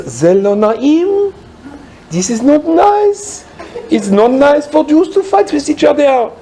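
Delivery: put-on high, slow scolding old woman. Old woman at the pump scolds two men fighting, high and slow. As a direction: tut at them like a disapproving grandmother. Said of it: "she says".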